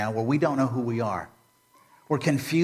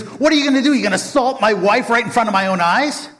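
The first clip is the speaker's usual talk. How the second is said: loud, much higher furious yell; angry king; 10 semitones higher, 6 dB louder, faster than usual. Acted. The king catching Haman on his wife, shouting in fury. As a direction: roar at him in outrage. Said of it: "he's real mad now".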